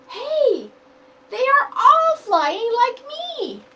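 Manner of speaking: high, loud, slow happy call; thrilled to see the others fly; delighted exclamation. Acted. Chick sees siblings flying.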